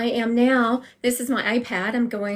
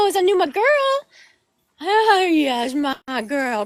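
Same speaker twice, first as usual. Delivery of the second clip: cooing to dog; 7 semitones higher, much slower than usual. Real, not acted.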